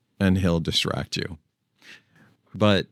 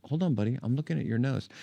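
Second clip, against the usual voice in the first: higher and softer, gentle aside; soft to the dog; 5 semitones higher, 7 dB quieter, much faster than usual. Real, not acted. Owner looking at the dog's nose, soft high voice. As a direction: murmur to the puppy up close.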